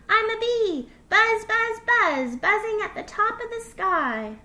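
high, loud little fox at play; playful excitement. Acted. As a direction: buzz about with childlike glee. Little fox plays at bee.

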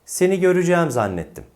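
"göreceğim" contracted to gö-rü-cæm; high, brisk model sentence with "göreceğim" as görücæm; spoken form. Shown as a demonstration. Teacher models a sentence, "göreceğim" said görücæm. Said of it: "Yanlış olan telaffuz ne?".

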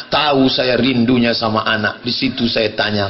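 normal talking voice

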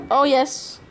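ordinary delivery

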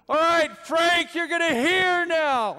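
high, loud and slow, a comic announcement; Jesus healing deaf Frank, hammed-up high and loud; hammed-up healer. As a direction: declare the healing with mock grandeur.

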